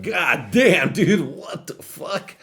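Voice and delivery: high, loud, drawn-out disbelief; shocked exclaim. Retold or imitated. Reacting to the narrator's story, loud mock shock.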